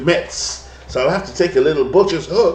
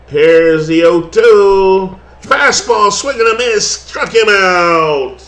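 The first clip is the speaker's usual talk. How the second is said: louder and higher, stretching the pitch call; excited strikeout call; 6 semitones higher, 7 dB louder, much slower than usual. Acted. Broadcaster calling the big strikeout, excited and loud. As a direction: build and bust out on the strikeout.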